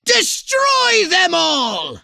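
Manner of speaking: slow, high, loud shouted order; rallying his agent to wipe out everyone; battle-cry command. Acted. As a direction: bellow it like unleashing an invasion. Alien commander ordering total destruction.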